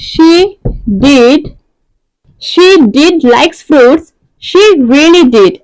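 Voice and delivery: did pressed hard, each word drawn out very slowly; stress on did. Shown as a demonstration. Teacher insisting to students that she really did like fruit. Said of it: "এখানে এই like শব্দটাকে বেশি জোর দিচ্ছে".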